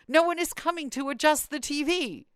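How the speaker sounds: high-pitched mock grievance; the mother's indignant phone complaint; lightly hammed-up mother complaining; whiny complaining mother.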